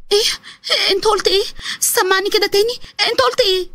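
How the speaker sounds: high-pitched disbelieving questions; stunned girl. Acted. Girl stunned by love confession. (بنت مصدومة بصوت عالي الطبقة)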